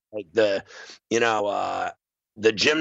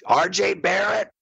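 mocking jab; 7 semitones higher than usual. Mocking the doctor's phrase.

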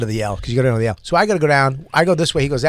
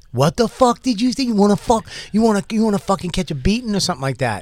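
higher threatening rant; mock street threat; 5 semitones higher, faster than usual. Stranger turning to threaten, mock higher aggression. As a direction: challenge him to a fight. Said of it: "he turned around immediately".

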